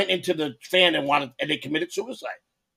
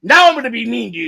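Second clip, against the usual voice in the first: mock angry threat; 6 semitones higher, 6 dB louder than usual. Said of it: "and he said".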